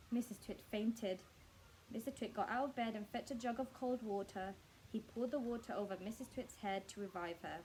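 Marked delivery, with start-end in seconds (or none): none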